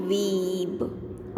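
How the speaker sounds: weeb stretched long and slow; slow modelling of weeb; drawn-out word. Shown as a demonstration. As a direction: hold the vowel in weeb for the children. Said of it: "W E B".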